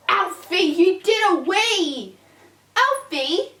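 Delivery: slow, loud, high disbelieving scold; shocked scolding. Real, not acted. Owner finding puppy wee on carpet, shocked slow scold. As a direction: drag out the disbelief.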